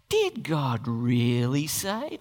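low, slow, sly snake voice; weird serpent voice. Acted. Preacher voicing the talking serpent to the congregation, low and slow. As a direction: drawl it out like a scheming serpent. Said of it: "I can't kinda get a weirdly enough voice for it".

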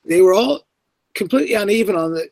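usual speaking voice

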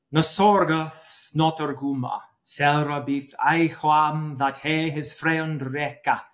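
put-on careful Old English recitation, "Na sorga snotorguma" spoken with measured pacing. Shown as a demonstration. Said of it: "I'll read it in Old English first".

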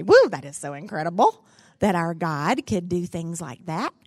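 loud, delighted praise; joyful exclamation. Acted. Preacher praising God for the miracle, loud and joyful. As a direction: burst out in amazement. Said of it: "and I just say".

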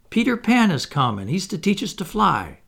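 high, excited announcement; cried out. A narrator's reading. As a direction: burst out with thrilling news. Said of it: "she cried".

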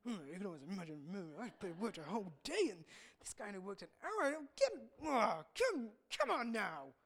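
low, soft grumbling under the breath; mock grumble. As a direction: mutter your resentment. Voices worker grumbling low.

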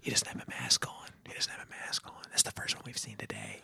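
imitated gossipy whisper. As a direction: whisper it like a bystander commenting on someone. Helpers whispering, overheard at self checkout.

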